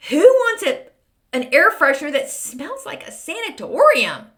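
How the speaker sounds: high, loud mocking disbelief; incredulous. Real, not acted. Mocking a weird scent name to viewers, high incredulous squeal. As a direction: squeal it as if it's absurd.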